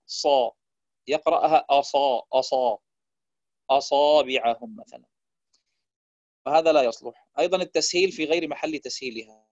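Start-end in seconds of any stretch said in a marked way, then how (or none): 0.1-4.8 أصابعهم opened with a dark, heavy back a; heavy hamza. Shown as a demonstration. Teacher showing a mistake, أصابعهم with a heavy hamza. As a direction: thicken the first hamza of أصابعهم. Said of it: "مثلًا تفخيمها عندما يقرأ".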